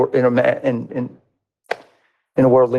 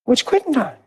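high, startled "what's quitting time"; high confused surprise; surprised; 11 semitones higher than usual. Retold or imitated. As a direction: jump up in pitch, caught off guard. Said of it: "I'm like".

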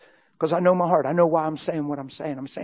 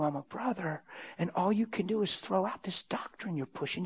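hushed, confidential rebuke; retold whisper; 8 dB quieter than usual. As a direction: lean in and say it softly, deliberately. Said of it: "I leaned in, I whispered".

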